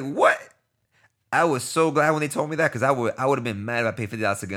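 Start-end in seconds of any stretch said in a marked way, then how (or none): 0.0-0.4 high, louder mock-incredulous "what?"; a sharp rise in astonishment; retold shock. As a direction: react in raised-pitch disbelief. Shocked retold reaction to entry fee.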